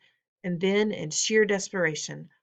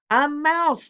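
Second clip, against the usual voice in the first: high, louder shout of alarm; 9 semitones higher, 5 dB louder than usual. A narrator's reading. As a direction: yell it out in panic. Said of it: "they were shouting".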